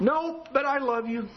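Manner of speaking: slow, breezy mock refusal; lazy refusal. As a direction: shrug it off from the recliner. Husband refuses, lazily.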